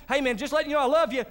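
breezy quick. Retold or imitated. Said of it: "So now it's not like just".

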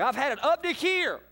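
exasperated parent. Retold or imitated. Retelling a parent's scolding, mock exasperated. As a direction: throw up your hands, high. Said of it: "it's like".

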